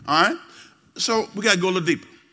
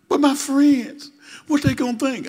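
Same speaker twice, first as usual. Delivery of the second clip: put-on higher, slow teenage protest; whiny teen; 6 semitones higher, 3 dB louder, slower than usual. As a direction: plead like a sulky sixteen-year-old. Teen pleads to keep phone.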